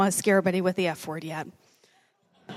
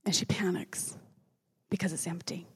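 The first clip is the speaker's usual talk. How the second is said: hushed whisper; 3 semitones lower, 10 dB quieter than usual. A narrator's reading. Preacher telling of Mary finding the tomb empty, whispered. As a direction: whisper it as if the tomb is right there.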